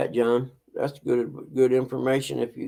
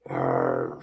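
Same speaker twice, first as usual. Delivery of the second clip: pirate growl; 3 dB quieter than usual. Pretend. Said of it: "Said the pirate".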